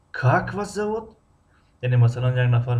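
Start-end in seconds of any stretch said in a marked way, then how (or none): 0.1-1.2 Как stretched and pitched high, the whole question raised; high-pitched question leaning on Как; stress on the first word. Shown as a demonstration. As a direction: start high on a long Как. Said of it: "аввали ҷумларо мо баланд талаффуз".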